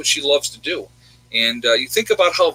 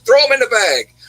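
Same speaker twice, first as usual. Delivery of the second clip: higher and louder, pushing the offer again; imitating the lady waving off the price; insistent repeat; 10 semitones higher, 6 dB louder than usual. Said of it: "She's like".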